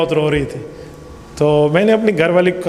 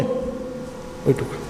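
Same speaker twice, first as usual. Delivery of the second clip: awed whisper; 6 semitones lower, 11 dB quieter, much slower than usual. Real, not acted. Preacher marvels at God's work.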